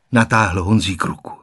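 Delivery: ordinary delivery, nothing marked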